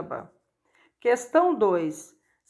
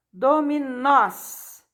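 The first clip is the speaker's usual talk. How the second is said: "Dominós" slowly, stressed on final "nós"; tônica em NÓS; 3 semitones higher, much slower than usual. Shown as a demonstration. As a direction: draw out "Dominós" and land on "nós". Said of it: "Nós é a palavra mais forte". ("Dominós" devagar, com força no "nós")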